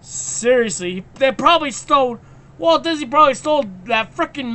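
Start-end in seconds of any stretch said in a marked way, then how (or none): none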